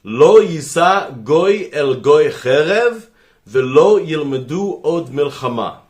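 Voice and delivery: Hebrew verse in a slow, careful Israeli accent. Shown as a demonstration. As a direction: recite it the way an Israeli would say it. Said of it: "I'll say it for your sake in Israeli accent".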